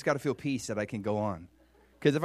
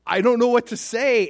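loud, high, at a loss; overcome; 11 semitones higher, 12 dB louder than usual. Acted. Showing how David feels, loudly overwhelmed. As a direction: exclaim it as if flooded with amazement. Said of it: "this is how David feels".

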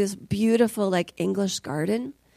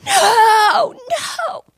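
very high, frantic scream; mad woman screaming; 13 semitones higher, 8 dB louder than usual. Retold or imitated. Mother facing down a roaring pit bull, frantic screaming. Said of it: "screaming at the top of my lungs like a mad woman".

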